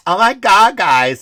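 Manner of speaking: hammed-up gushing woman voice; horrible woman impression. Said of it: "This is my horrible woman impression".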